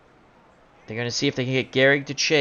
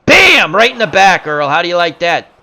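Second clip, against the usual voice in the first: explosive high shout, easing slightly; gloating exclamation; 4 semitones higher, 9 dB louder than usual. Pretend. Announcer gloats at Earl hit in the back, loud. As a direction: crow loudly over the direct hit. Celebrates hitting the batter.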